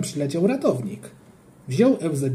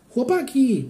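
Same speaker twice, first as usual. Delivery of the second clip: high call to friends across the pool; 8 semitones higher, slower than usual. A narrator's reading. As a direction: shout for the boys' attention, pitched up. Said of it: "krzyknąłem".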